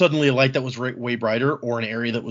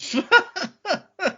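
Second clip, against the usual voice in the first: bursting high laugh; falling high-pitched laugh. Real, not acted. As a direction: let a real laugh out. Amused at the theory.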